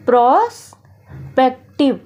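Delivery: Prospective stretched out slowly, syllable by syllable; slow, drawn out. Shown as a demonstration. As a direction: say prospective slowly and clearly for the class. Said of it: "prospective को हम easy तरीके से".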